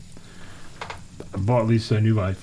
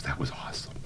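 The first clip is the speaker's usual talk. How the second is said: hushed, voiceless impressed aside; conspiratorial whisper; 10 semitones lower, 14 dB quieter than usual. Retold or imitated. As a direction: whisper secret admiration as the clerk. Clerk's secret praise after the tirade.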